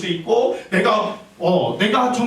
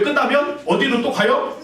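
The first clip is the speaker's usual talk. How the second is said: ordinary delivery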